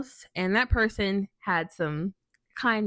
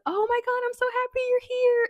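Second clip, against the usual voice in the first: gushing coworker. Retold or imitated. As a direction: gush like an overjoyed new friend.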